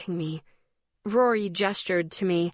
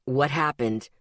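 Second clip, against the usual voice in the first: much lower, sharp male demand; 5 semitones lower than usual. A narrator's reading. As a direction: demand it in his low voice. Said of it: "he demanded".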